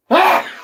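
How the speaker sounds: sudden high, loud laugh bursting out; loud burst of laughter. Real, not acted. Reacting to a sponsorship joke, laughing loudly. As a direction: cracks up loudly.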